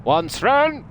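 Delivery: shrill call for the servant. Pretend. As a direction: shout for the butler across the mansion. Calling the butler by the wrong name.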